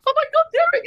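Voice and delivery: retold bawling. Author phoning husband Derek, mock tearful high wail. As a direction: mimic crying down the phone. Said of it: "I'm bawling my eyes out".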